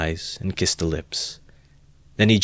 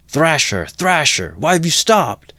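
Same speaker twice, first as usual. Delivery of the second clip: high, loud egging-on yell; jeering shout; 10 semitones higher, 7 dB louder than usual. A narrator's reading. Drunk crowd urging Mikolka on, jeering shout. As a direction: goad him on from the crowd. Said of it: "shouted voices in the crowd".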